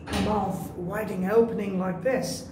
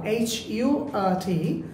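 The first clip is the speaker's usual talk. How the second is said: ordinary talk, nothing marked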